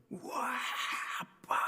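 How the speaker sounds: imitated child's high, hushed wow; amazed child. Son spotting something by the rubbish area, imitated amazement. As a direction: gasp out a child's wonder to Dad. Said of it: "애가 막 놀란 거예요".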